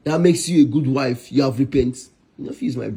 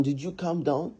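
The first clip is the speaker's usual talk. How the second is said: softer, falling-away challenge with a final rise; pointed question; 9 dB quieter than usual. Acted. Husband scolding wife over her temper, softer pointed question.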